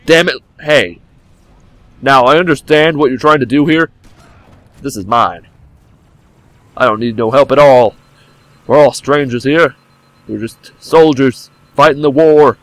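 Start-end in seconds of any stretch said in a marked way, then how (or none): none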